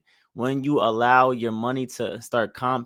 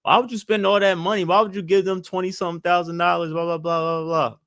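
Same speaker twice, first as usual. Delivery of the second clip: higher, fast, mock-incredulous whining; mocking skeptical friend; 4 semitones higher, faster than usual. Vlogger mocking friends who doubt paying off debt. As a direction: parrot a disbelieving friend. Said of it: "friends of yours that say".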